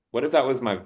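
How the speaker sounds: ordinary delivery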